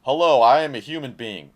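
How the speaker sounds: loud, hammed-up robot hello; mock robot greeting. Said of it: "Who are you?".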